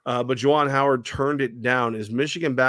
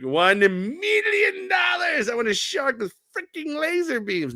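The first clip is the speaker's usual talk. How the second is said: much higher, slow shouted villain voice; cartoon villain voice. Host mocks the idea the fan is a villain, a shouted cartoon-villain voice. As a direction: ham it up like a movie supervillain. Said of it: "no, like".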